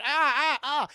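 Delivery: loud, high repeated mock shrieks; retold girl's screams; pained screams. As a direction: cry out in bursts as if your ears hurt. Girl hurt by hearing aid.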